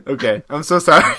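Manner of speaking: sorry while still cracking up; laughing apology. Real, not acted.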